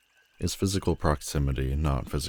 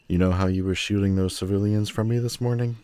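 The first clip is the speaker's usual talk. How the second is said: usual speaking voice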